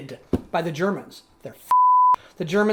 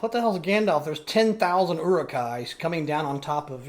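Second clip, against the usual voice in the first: usual speaking voice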